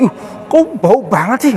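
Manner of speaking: high-pitched acted disgust; bullying jeer. Preacher giving an example of bullying, high mocking complaint. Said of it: "Wah, itu udah bullying".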